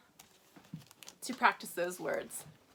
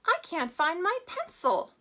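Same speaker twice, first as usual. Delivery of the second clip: lightly voiced kid, higher and pitched up in complaint; whiny child; 7 semitones higher, 5 dB louder than usual. Teacher imitating a student's complaint, lightly voiced kid. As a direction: play a student who lost a pencil, light touch. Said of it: "I remember hearing a lot of that".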